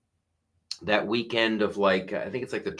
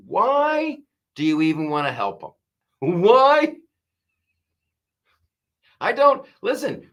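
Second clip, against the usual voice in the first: rising, louder incredulous outburst; exasperated; 9 semitones higher, 5 dB louder than usual. Retold or imitated. Comedian answering the Fox pundits, loud exasperated rising outburst. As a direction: throw up your hands in disbelief. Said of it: "I'm like".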